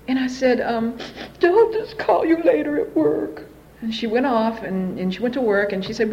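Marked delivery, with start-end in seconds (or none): 1.4-3.4 slow, high tearful whine; fake crying. Daughter putting mother off before work, slow tearful whine. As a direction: drag it out through put-on sobs.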